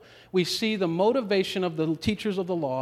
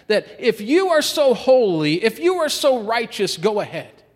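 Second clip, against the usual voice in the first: higher and louder, daring the righteous; challenging preacher shout; 8 semitones higher, 9 dB louder than usual. Acted. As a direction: throw the challenge out hard at the accusers. Jesus challenging the religious leaders.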